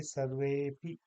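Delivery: सर्वेपि with a drawn-out वे; सर्वेपि as one word, dropped अ; joined long e. Shown as a demonstration. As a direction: stretch the वे in सर्वेपि, then पि. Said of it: "इसको हम कहेंगे".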